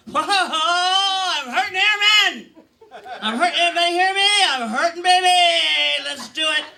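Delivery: high, loud mock screaming of pain. Comedian acts out her ambulance screams, loud and high. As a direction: wail it out like an over-the-top patient. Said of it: "I'm screaming really loud".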